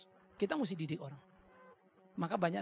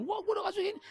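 high-pitched mock grumble, much higher; grumbling complaint; much faster than usual. Giver grumbling about money he gave away, high mock whine. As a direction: whine it like someone regretting a gift. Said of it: "Lalu kita ngedumel-ngedumel".

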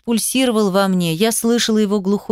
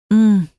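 moan; 5 dB louder than usual. Acted. Heroine moans during love scene.

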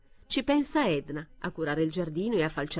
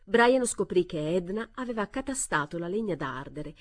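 ordinary delivery, nothing marked

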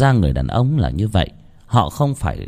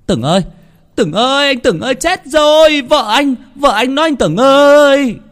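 high, frantic cries building louder; panicked calling; 5 dB louder than usual. A narrator's reading. Tý calls Tửng about his wife, panicked. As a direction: shout for help in a rising panic. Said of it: "tiếng gọi hốt hoảng của thằng Tý".

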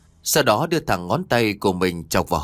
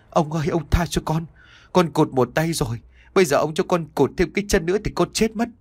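higher, panicked pleading; desperate cry; 5 semitones higher than usual. Acted. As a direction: cry out for mercy in terror. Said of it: "anh Kiều liền gào lớn".

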